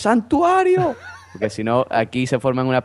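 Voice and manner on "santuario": high, yelled plea for sanctuary; mock panicked cry for refuge. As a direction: shout it like you're fleeing to safety.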